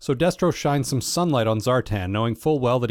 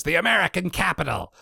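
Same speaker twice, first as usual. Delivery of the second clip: Cobra Commander impression; 5 semitones higher than usual. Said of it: "Cobra Commander replies".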